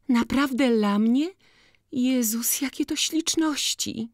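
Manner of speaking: put-on high, thrilled cry of a girl given a gift; delighted exclamation. A narrator's reading. To Boryna, unwrapping his gift ribbon, exclaiming with joy. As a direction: soar up in sheer delight. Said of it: "wykrzyknęła".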